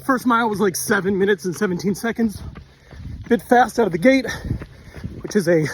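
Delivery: talking mid-run, a bit winded; while running. Real, not acted. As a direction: speak while jogging along. I'm holding this phone, talking to you.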